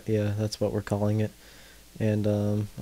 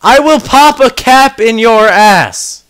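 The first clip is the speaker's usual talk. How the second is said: very high, very loud, drawn-out shout; full-volume furious yell; angry threatening yell; 13 semitones higher, 18 dB louder, slower than usual. Real, not acted. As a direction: scream the threat slowly, word by word. Yelling threat at people in the house.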